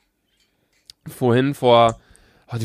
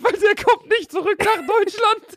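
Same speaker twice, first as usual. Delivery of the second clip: laughing. Real, not acted. Laughs at his own joke.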